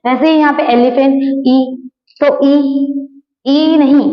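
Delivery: ordinary talk, nothing marked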